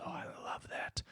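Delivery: breathy whispered crowd reaction; an audience whispering its delight. Retold or imitated. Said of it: "And then the crowd goes".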